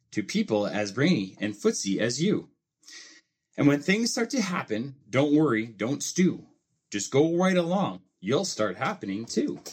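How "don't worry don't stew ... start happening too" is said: plain reading voice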